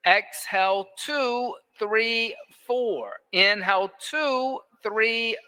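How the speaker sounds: evenly spaced, measured counting; metronome breath count. Real, not acted. Yoga teacher pacing the class's breathing, metronome count. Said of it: "that metronome breath count".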